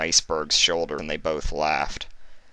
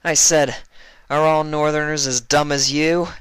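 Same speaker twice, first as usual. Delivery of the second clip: much higher, louder jeer; smirking taunt; 10 semitones higher, 6 dB louder than usual. Acted. Vinyl repeating her insult to Iceberg, loud smirking jeer. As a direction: fling it back at him, smug. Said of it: "Vinyl repeated with a smirk".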